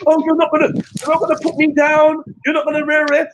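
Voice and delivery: high, whiny mock of Betty. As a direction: squeak it out like a spoiled woman. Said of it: "Get me?".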